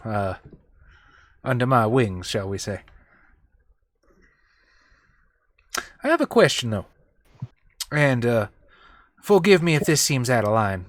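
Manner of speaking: polite Texan doubter, measured; San Antone country voice, deferential but doubtful; folksy San Antone character. Acted. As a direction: question the boss courteously, down-home. Player plays San Antone character.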